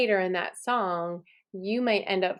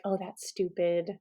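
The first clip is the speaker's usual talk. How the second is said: a hint of a dismissive inner critic, softer; 3 semitones lower, 5 dB quieter than usual. Teacher voicing the inner critic that doubts a new song, lightly dismissive. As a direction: mutter it like the doubting voice in your head. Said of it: "the little voices in your head are gonna be like".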